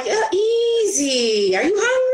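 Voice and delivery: slow then high squeaky question; a light doubtful customer; lightly mimicked wary client; mock skeptical client. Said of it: "people are like".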